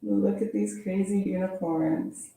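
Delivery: ordinary delivery, nothing marked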